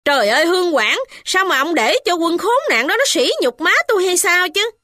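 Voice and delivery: angry shout. A narrator's reading. Wife shouting at the constable, furious high cry. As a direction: cry out in outrage. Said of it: "mợ tức giận nên la lớn".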